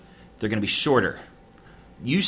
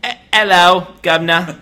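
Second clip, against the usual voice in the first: loud, high put-on cockney hello; hammed-up London street accent; mock cockney greeting; 5 semitones higher, 8 dB louder than usual. Host greets co-host in mock cockney accent.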